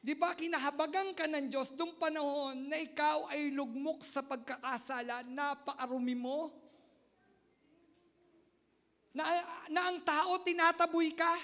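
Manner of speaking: high, loud preaching at full force; fervent preaching. Acted. Pastor urging the congregation with loud fervent preaching. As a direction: preach it with rising fire.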